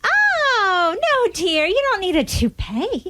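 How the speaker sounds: high, sweet, doting voice; dotty wife. Acted. Wife sweetly misunderstands her husband, high and doting. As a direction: coo at him without listening.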